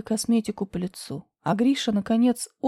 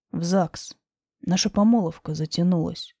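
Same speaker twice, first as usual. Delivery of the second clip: lower, calm man's voice; low male voice; 5 semitones lower than usual. Acted. Grisha low.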